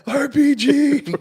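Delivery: very high, loud, stretched-out alarm shout; mock yelling. Co-host mimicking the soldier's warning on guard, mock yelling. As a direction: yell it like a hammed-up battlefield warning.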